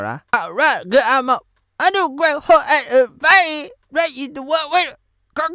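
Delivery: ordinary talk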